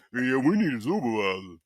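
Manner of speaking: low, soft put-on complainer voice; quiet mock quote; mocking imitation. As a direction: mimic a customer wanting the boss, low and dopey.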